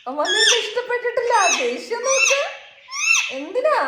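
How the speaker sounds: laughing, high-pitched teasing call to the pet; amused, high-pitched cooing at a grumpy pet. Real, not acted.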